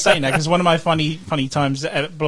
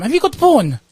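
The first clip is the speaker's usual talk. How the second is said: high, louder question; customer asking; 9 semitones higher, 5 dB louder than usual. Retold or imitated. Woman at the counter asking the clerk, high. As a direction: ask it high and earnestly. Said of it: "one more time".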